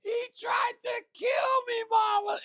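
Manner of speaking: high, wailing, slow sobbing plea; imitating his own sobbing. Fighter retelling sobbing to his mother, wailing high. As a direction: cry it out like a hurt little boy. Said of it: "I was crying like a baby".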